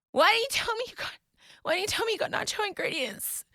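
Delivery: high, fast indignant demand; accusing. Retold or imitated. Wife demanding answers from her husband, high and accusing.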